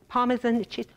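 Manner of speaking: usual speaking voice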